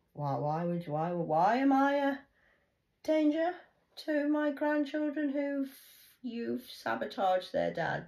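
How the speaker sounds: mother protesting the accusation; outraged protest. Real, not acted. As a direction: climb in outrage.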